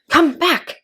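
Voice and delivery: high, loud call after her. A narrator's reading. Caterpillar calling after departing Alice, loud. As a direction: call her back across the distance. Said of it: "the caterpillar called after her".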